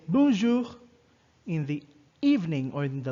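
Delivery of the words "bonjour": "bonjour" pronounced in French, model for the class. Shown as a demonstration. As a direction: say "bonjour" the French way, nasal bon, throat R. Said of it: "you say".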